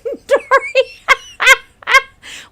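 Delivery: high burst of real laughter; loud laugh. Real, not acted. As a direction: laugh out loud at the silly gift. Laughing at ugly fan gift.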